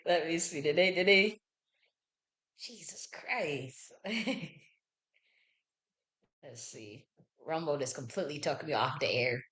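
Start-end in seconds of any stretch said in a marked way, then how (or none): 2.6-7.0 slow breathless whisper of disbelief; exasperated whisper. Real, not acted. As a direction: whisper it, fed up with the stream. Stream glitch frustration.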